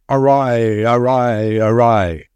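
a booming, drawn-out battle call, held in check; great cry. A narrator's reading. A great voice heard above the battle, crying out. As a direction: call it out like a voice over thunder. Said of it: "a shout louder than a thunder peal, crying".

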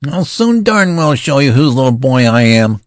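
put-on high, loud boyish fury; ferocious voice. A narrator's reading. Boy general threatening the amused colonel, high ferocious shout. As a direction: spit it out like an outraged child. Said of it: "in a ferocious voice".

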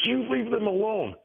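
imitating the activist woman; mock woman scolding. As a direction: mimic her telling him off. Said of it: "She goes".

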